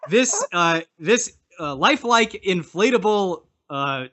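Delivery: cheesy late-night ad read; infomercial pitch. Acted. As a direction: sell it like a TV ad.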